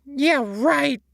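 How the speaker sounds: high, loud, scoffing; sarcastic high sponge scoff; huffed. A narrator's reading. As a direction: dismiss him with a huff. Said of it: "SpongeBob huffed".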